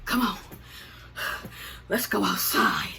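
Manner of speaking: imitating someone winded, breathy and slow; mock panting, mostly breath. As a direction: gasp the words out between heavy breaths. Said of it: "why the fuck is she out of breath".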